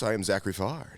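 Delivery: normal talking voice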